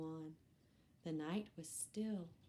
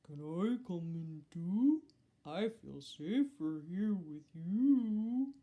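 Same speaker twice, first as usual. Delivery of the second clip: louder, slow cow asking in; 7 dB louder than usual. Acted. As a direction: drawl it out like a big gentle cow. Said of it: "said Cow".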